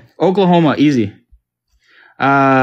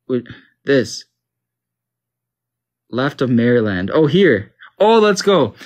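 usual speaking voice

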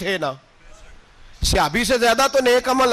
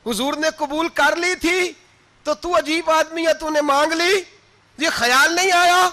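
angry rebuke; 8 semitones higher than usual. Acted. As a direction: build up to a sharp reproach. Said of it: "एक सहाबी गुस्से से बोले".

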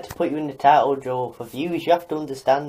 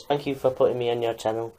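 ordinary talk, nothing marked

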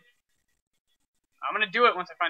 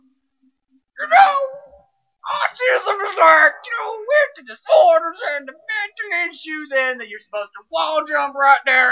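high, shouting mock impression; impression of his friend; 9 semitones higher, slower than usual. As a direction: squeal like an overexcited friend. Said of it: "You do a better one".

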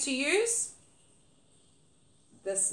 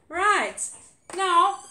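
normal talking voice

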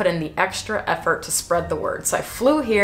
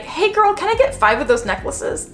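Meghan Markle; 11 semitones higher than usual. Retold or imitated. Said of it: "Meghan Markle to be like".

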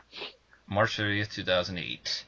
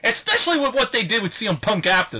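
high, loud, laughing outrage; heated rant; 13 semitones higher, 5 dB louder than usual. Real, not acted. Wrestling fan venting to co-hosts, loud high outrage. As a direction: vent like a wronged fan. Ranting about Carlito's loss.

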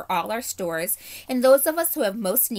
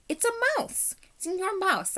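much higher, delighted surprise; surprised discovery; 12 semitones higher than usual. A narrator's reading. Doctor spotting a mouse at his feet, high and surprised. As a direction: jump up in pitch at the tiny creature.

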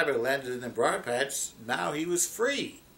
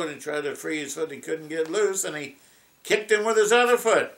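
ordinary delivery

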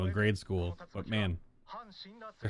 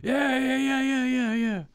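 much higher, louder, shouting yeah over and over; mock an overeager Japanese yes-sayer, yelling each yeah; a run of high shouted yeahs, hammed up; eager yes-man; 6 dB louder, slower than usual. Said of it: "And they're like".

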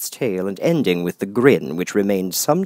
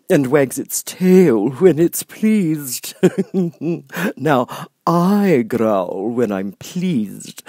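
grinning Cheshire Cat; 7 semitones higher, 8 dB louder, much slower than usual. Acted. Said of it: "the cat went on".

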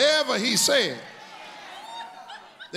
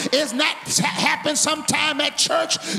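rising, shouted preaching, much higher; preaching at full force; 7 semitones higher than usual. Acted. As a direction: build the call into a whoop over the music. Preacher building to whoop.

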